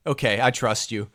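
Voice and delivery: ordinary delivery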